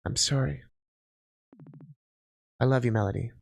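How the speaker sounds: low, soft, resigned; dejected parting. Acted. Partner leaves Mel after quarrel, low and sorrowful. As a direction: give in sadly and quietly.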